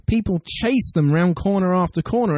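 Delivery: ordinary talk, nothing marked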